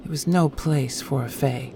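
ordinary delivery

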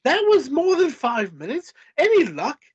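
high, exasperated British voice; exasperated British woman; Andrea, British. A narrator's reading. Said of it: "my Andrea suddenly became British".

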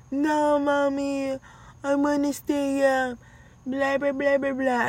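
high imitation of a child whining; whiny kid voice. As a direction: mimic the kids begging to stay up. Kids protesting bedtime.

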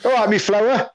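put-on lilting regional British accent, rising high; regional British greeting. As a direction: greet them in a broad British dialect. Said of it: "they walk past you and go".

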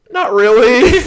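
high, loud squeak breaking into laughter; mock nervous denial. Driver asked if he smokes, comically squeaky nerves. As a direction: deny it in an exaggerated squeal. Nervous answer about smoking.

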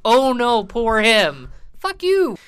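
high, loud mock pity turning to contempt; scornful. Real, not acted. Host ranting about a freed offender, scornful. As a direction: sneer the sympathy, then lash out.

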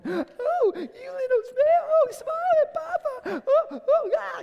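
squealing, loud coo over a newborn; hammed-up doting grandpa squeal; high-pitched baby talk. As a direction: voice shoots up octaves, gushing at a baby. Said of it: "your voice goes up like five octaves".

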